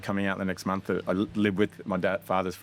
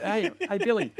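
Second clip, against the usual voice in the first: hyped-up filmmaker; 12 semitones higher, slower than usual. Retold or imitated. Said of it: "I'm like".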